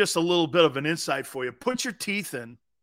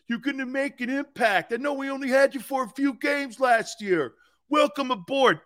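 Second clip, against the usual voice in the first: higher put-on voice of a fan calling in; South Philly caller; 6 semitones higher than usual. Prank caller greeting the radio host, higher voice. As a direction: play a chummy local caller in a raised voice. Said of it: "Louie from South Philly".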